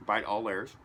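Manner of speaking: talking through a mouthful; mouth full. Real, not acted. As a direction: say it while chewing a bite. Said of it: "Mom told me not to talk with my mouth full".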